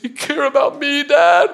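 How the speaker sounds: high-pitched mock tearful kid voice; imitated crying son. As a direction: imitate a little boy asking through tears. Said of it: "I'm looking at him crying".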